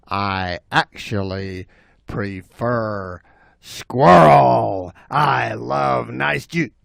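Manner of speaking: slow, low savoring; relishing bobcat. Acted. Bobcat choosing squirrel instead, low slow relish. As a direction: linger on every word hungrily.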